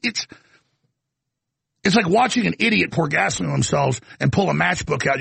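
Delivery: ordinary talk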